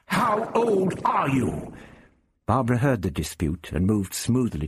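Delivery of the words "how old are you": high, slow, incredulous challenge; outraged demand. A narrator's reading. The Doctor demands his rival's age, outraged. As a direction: draw out each word in affronted disbelief. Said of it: "the doctor roared".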